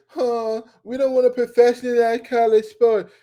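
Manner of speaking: high, loud mocking voice; mock college official. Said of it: "stop lying to ourselves and saying".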